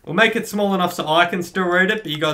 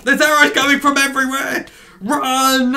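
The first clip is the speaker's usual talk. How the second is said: loud, high alarm; urgent shout; 10 semitones higher, 6 dB louder than usual. Real, not acted. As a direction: yell at them to flee. Fleeing arrow fire.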